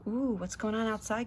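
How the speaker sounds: ordinary delivery, nothing marked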